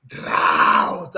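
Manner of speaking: Drought barked very low, as if to gulp the listener down; mock swallowing bark. Teacher hamming up the wrong reading for laughs. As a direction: boom Drought out, mocking. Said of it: "as if you want to swallow me".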